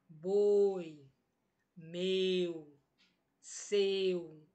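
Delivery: "boi, meu, seu" low and drawn out, vowels closed; closed diphthongs. Shown as a demonstration. Teacher contrasting closed diphthongs for students, "boi, meu, seu" low with closed vowels. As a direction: barely open the mouth on "boi, meu, seu", pitch dropped. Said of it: "eu fico mais fechado".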